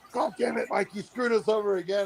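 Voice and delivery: high-pitched, exasperated imitation; mock a high-strung buddy's complaint; high-strung brother-in-law. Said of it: "who's high strung".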